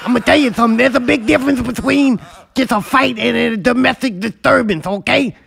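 tough guy voice. Acted. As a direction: bluster like a hothead bragging. Acting the angry abuser.

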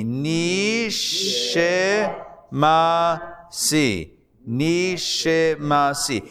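Nishimasi stretched out very slowly, ni-shi-ma-si; slow reading aloud. Shown as a demonstration. Teacher leading the class in unison, slow Nishimasi. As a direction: lead the class through Nishimasi syllable by syllable. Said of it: "say it with me".

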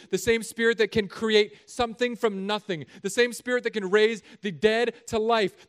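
loud, high, building proclamation; preaching at full force. Acted. God promising His Spirit to the man, loud and building. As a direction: proclaim the promise with rising power.

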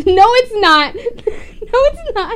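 high, loud, laughing denial; amused, insistent outburst; laughing protest. Real, not acted. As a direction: protest loudly while cracking up. Laughing protest at friend's excuse.

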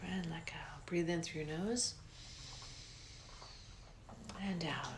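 breathy guided relaxation, unhurried; soft spa whisper. Acted. Leads a guided meditation.

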